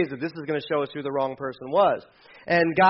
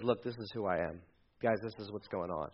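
low, hushed admission; a hushed, owned-up confession; quiet confession; 7 semitones lower, 12 dB quieter than usual. Acted. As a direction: confess it softly to the crew.

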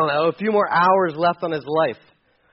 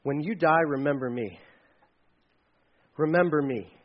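softer, lower, hushed plea; quiet plea; 5 semitones lower, 7 dB quieter than usual. Retold or imitated. Dying thief asking Jesus to remember him, hushed. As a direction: say it low and humble, begging to be remembered. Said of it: "to say to Jesus".

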